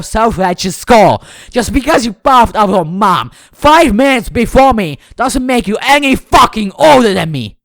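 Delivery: loud, furious tirade, pitch climbing; booming angry outburst that keeps building. Acted. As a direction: blow up at your sister. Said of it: "my booming voice".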